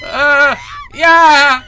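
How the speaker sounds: high, loud terrified cry; a trapped girl screaming in fright; frightened scream. Acted. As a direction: shriek in panic at being found. Girl startled in dark room.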